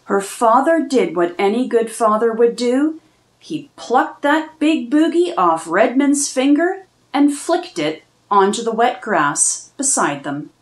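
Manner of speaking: ordinary delivery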